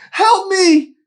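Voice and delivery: very loud, very high scream; cry for help. Acted. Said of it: "she cried".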